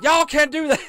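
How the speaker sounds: high, loud yell in a bad Southern twang; failed Southern accent. Mocking Frank yelling again in a bad Southern accent. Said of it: "I can't do Frank's accent".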